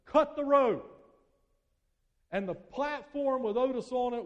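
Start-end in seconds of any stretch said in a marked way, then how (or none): 0.1-1.0 loud, high shouted order; a sharp shouted command; yell. Acted. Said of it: "he would yell to his assistant".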